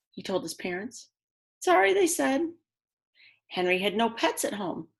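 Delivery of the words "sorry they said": parents' apology. Acted. Parents refuse again.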